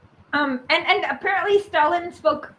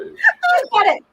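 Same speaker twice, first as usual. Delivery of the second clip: laughing squeak; 10 semitones higher, slower than usual. Real, not acted. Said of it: "my Chomsky voice".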